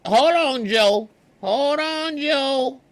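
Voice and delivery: high and loud, playful pleading; falsetto banter. Retold or imitated. Still in the falsetto voice, asking Joe to repeat. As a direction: keep the high granny voice going. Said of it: "bantering with Joe in character".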